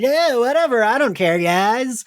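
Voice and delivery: smarmy, dismissive. Retold or imitated. Imitating smarmy Angelus to co-hosts, smarmy and dismissive. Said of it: "so shitty and smarmy and just like".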